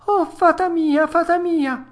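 high, loud frightened wail; panicked shrieking. Acted. Puppet shrieking to the fairy, high panic. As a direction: shriek for help, voice way up. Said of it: "cominciò allora a strillare il burattino".

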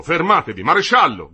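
very loud, high-pitched yell; urgent shout. A narrator's reading. The delegate calls the marshal as workers advance, urgent shout. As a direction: yell to halt them and alert the marshal. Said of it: "gridò".

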